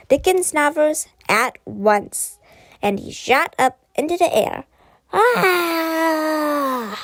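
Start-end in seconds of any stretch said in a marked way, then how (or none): 5.1-6.9 high, loud falling scream. A narrator's reading. Teacher screaming as he is launched into the sky. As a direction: scream as you shoot upward. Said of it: "he screamed".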